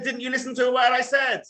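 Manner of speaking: high, loud mock shout; imitating her shouting. Wife shouting at husband over the dry-cleaned shirts, high mock yell. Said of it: "she starts shouting again".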